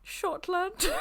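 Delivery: high-pitched hint of a German accent. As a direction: answer in a put-on German-tinged squeak. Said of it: "Was that your German accent".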